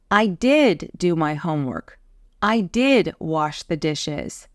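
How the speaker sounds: emphatic "did" in each sentence; stress on DID. Shown as a demonstration. Teacher showing emphatic do, strong stress on "did". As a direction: lean firmly on each "did". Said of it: "do helps us emphasize".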